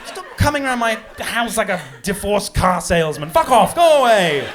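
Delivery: high, loud, outraged shouting; indignant yelling tirade at door-knockers; furious rant. Retold or imitated. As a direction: rant it out in mock fury. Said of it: "The media was like".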